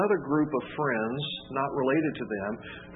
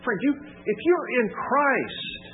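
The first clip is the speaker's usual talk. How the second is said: much higher and louder, slow building cry; preaching at full force; 12 semitones higher, 4 dB louder, slower than usual. Acted. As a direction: ring it out to the whole church. Sermon climax.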